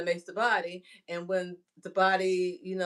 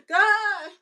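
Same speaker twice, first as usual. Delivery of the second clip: exasperated plea to God; 13 semitones higher, 8 dB louder than usual. Retold or imitated. Calling out to God.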